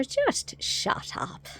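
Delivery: slow, high, drawn-out shushing; mock-weary repeated dismissal; goblin helper. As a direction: imitate a fed-up goblin, stretching each word. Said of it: "the little goblin guy does that was helping Sarah".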